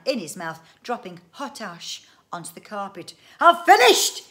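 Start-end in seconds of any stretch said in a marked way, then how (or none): 3.4-4.2 high, loud shout of triumph; triumphant cry. A narrator's reading. Inventor bursts in on his family, triumphant shout. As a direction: burst out with it at full volume. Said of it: "he cried it triumphantly".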